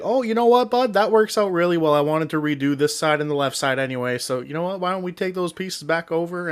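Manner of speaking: high, friendly Canadian voice; Canadian neighbor impression. Neighbor offering to take the fence back, high Canadian accent. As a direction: sound like the agreeable Canadian neighbor. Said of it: "good, good old Canadian guy".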